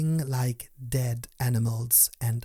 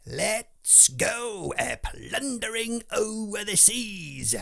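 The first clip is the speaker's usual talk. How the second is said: pirate shout; 10 semitones higher than usual. A narrator's reading. Pirate captain rousing his crew, high bellowing shout. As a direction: roar it out like a rowdy pirate. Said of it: "Long Johns shouted".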